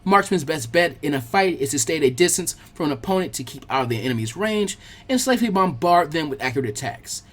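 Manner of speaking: usual speaking voice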